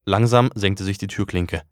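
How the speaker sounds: ordinary delivery, nothing marked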